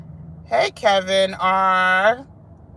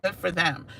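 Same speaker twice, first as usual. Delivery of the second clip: normal talking voice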